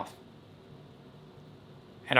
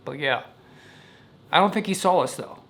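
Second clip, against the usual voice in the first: ordinary talk, nothing marked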